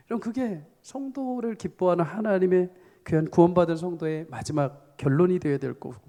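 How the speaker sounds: ordinary talk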